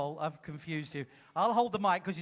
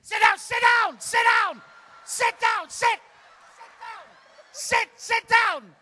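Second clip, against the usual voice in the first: loud, higher repeated commands; shouting; 12 semitones higher, 7 dB louder than usual. Real, not acted. Host shouting for the crowd to sit down, loud commands. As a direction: bark at them to sit down.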